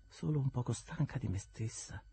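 lightly voiced cool young woman; a lighter, detached young voice; Estella, cool. Estella answers Havisham coolly, lightly voiced.

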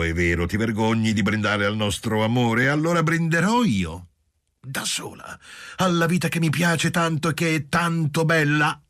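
put-on a drunk bride toasting life; tipsy wife. A narrator's reading.

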